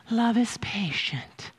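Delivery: soft, mock-sweet wedding reading; ushy-gushy. Mocking the verse as read at weddings, soft and ushy-gushy. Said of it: "ushy-gushy thing to be only read at weddings".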